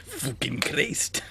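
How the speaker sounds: slow, laughing, whispered Scottish curse; a half-laughed Scottish swear; Scottish accent. Acted. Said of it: "the only two words I can say in Scottish".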